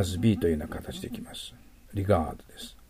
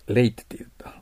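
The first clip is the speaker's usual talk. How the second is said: ordinary talk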